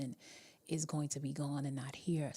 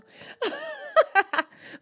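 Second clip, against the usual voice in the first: high laughter; 12 dB louder than usual. Real, not acted. Laughing at her own bad accent.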